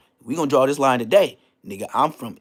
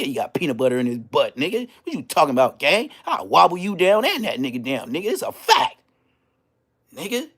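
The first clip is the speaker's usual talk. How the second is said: ordinary talk, nothing marked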